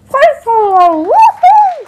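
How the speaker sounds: loud, high triumphant whoop; excited cheer. Real, not acted. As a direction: cheer out the win. Finishing the challenge, cheering.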